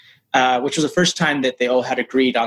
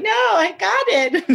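much higher, slow and giddy; elated insistence; slower than usual. Retold or imitated. Agent doubts him, he insists with giddy joy. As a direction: stretch it out, beaming.

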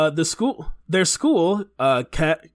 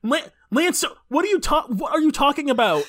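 higher, mock disbelief, stumbling; incredulous outburst; 10 semitones higher than usual. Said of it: "I'm like".

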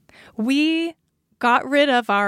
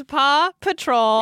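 slow Paw Patrol; slower than usual. Shown as a demonstration. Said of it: "I'm gonna be saying it faster".

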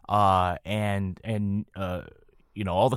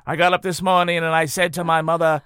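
put-on loud, raised Boston Kennedy accent; 5 semitones higher, 5 dB louder than usual. Imitating Ted Kennedy introducing himself downtown, Kennedy accent. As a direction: orate it in a broad Kennedy accent. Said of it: "get into the accent now".

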